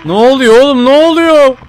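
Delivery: high loud frightened cry, rising; panicked. Real, not acted. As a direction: yell in alarm at the noise. Panic at noises in game. (panik içinde yüksek sesle bağırarak)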